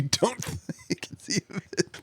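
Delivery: whispered through laughter; breaking into giggles while speaking; laughing whisper. Real, not acted. Host teases guest about missing the clock, laughing whisper.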